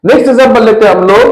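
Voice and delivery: ordinary talk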